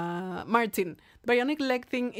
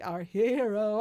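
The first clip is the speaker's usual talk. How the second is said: robotic autotuned voice, chanted; autotune cyborg voice; 4 dB louder, slower than usual. Shown as a demonstration. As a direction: sing it like a heavily autotuned cyborg. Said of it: "really aggressive autotune".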